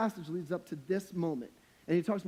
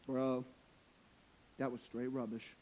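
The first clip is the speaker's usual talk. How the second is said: low, quiet mock verdict; hushed, low-pitched dude answer; deadpan reply; 7 semitones lower, 8 dB quieter than usual. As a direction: drop low and soft, as the unimpressed friend.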